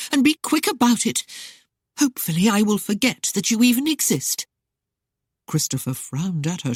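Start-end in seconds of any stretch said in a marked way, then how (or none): 0.1-4.4 higher, louder angry outburst; cried out. A narrator's reading. Jealous lady crying out at her suitor, higher and louder. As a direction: hurl it at him. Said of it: "she cried".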